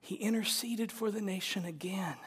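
hushed whisper. Real, not acted. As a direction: drop to a whisper for weight. Sudden whisper in sermon.

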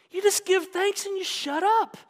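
high, loud, triumphant repeat of shut up; loud joyful refrain; excited shout. Real, not acted. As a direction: belt it out to the congregation. Repeats the quote line loudly.

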